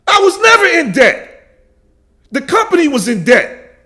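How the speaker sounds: high, loud, insistent denial; real furious denial; angry shout. Real, not acted. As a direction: yell it out, stressing never. Denying the debt claim, enraged.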